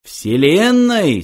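high, drawn-out impassioned cry; passionate exclamation. A narrator's reading. Second programmer adding to the great question, passionately. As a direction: stretch it out with rising passion. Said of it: "страстно воскликнул Ланквилл".

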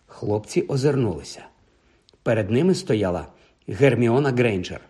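plain reading voice

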